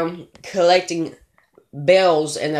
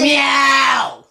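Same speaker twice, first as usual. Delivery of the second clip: loud, high shouted meow; cat meow; 9 semitones higher, 6 dB louder than usual. Pretend. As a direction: meow loudly to silence him. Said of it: "I did that to make you shut up".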